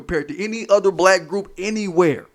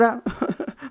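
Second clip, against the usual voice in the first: real laugh; 7 semitones higher, 7 dB quieter than usual. Real, not acted. Laughs after mock quote.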